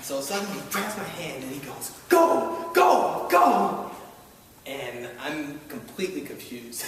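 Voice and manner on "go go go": very high, loud repeated cry; preacher urging the healing on; fervent shout. Retold or imitated. Reverend urging during healing.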